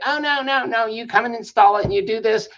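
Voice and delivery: high-pitched, insistent mimicry of a manager; mock a pushy manager laying down orders; imitated bossy yelling. Manager refusing the contractor a deposit, imitated bossy yelling. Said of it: "the way he was yelling".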